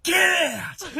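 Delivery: high, loud enraged bellow; dad screaming. Retold or imitated. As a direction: yell like a dad throwing out an intruder. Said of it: "he just screams at Harry".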